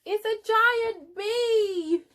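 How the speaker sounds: very high, slow, wondering shout; amazed little bees; thrilled mock-awed cry; excited cry. A narrator's reading.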